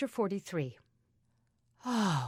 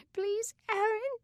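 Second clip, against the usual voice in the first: put-on very high little girl's voice, pleading through sobs; a tiny sister begging between sobs; little sister pleading with her brother, high and tearful; sobbing little girl. A narrator's reading. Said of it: "hiccuping her sobs".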